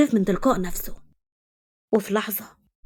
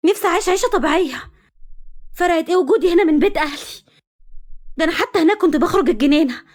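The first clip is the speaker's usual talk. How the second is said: angry raised voice; 6 semitones higher than usual. Acted. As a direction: let the anger burst out, voice climbing. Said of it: "دهب بغضب وغصب عنها عالي صوتها".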